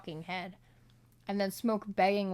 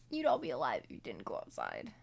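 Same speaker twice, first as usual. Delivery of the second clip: low scolding; 10 semitones lower, 3 dB quieter than usual. Acted. Scolding the characters.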